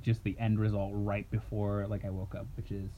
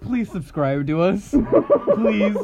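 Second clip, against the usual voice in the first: laughing, slow, higher and louder; 10 semitones higher, 9 dB louder, much slower than usual. Real, not acted. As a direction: plead through helpless laughter. Laughing plea to subscribe.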